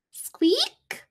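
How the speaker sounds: high rising squeak; a mouse's squeak. Acted. Squeaky noise in story.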